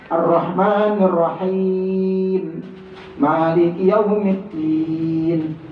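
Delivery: slow drawn-out "Maliki Yaumiddin" with a thin din; slow careful tajwid reading; model recitation. Shown as a demonstration. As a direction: recite deliberately, long final vowel. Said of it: "Itu tipis din".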